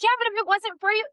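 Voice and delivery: high-pitched imitation of a grateful client; protesting client. Client insisting to her helper, high gushing protest. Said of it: "And she's like".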